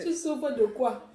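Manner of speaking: ordinary talk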